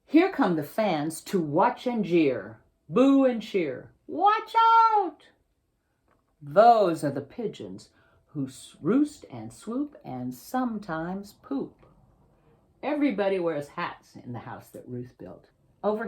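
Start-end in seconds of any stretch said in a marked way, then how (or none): 0.0-3.9 put-on slow, building read to the kids; lively storybook reading. Author reads picture book aloud. 4.1-5.3 very high, loud cry of warning; warning shout. Acted. Warning call in the story. 6.4-11.9 picture-book reading, lively and clear; reading to children. Acted. Author reads picture book aloud.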